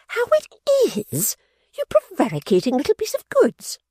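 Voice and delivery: very high contemptuous cry; Fanny scolding her sister; shrill retort. Acted. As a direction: hurl the insult at her sister. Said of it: "cried Fanny".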